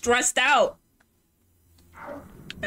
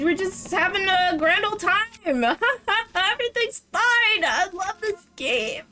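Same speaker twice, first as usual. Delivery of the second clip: forced cheerfulness; 11 semitones higher than usual. Pretend. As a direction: insist all is fine, laughing and rising into a squeal. Falsely cheerful while losing at game.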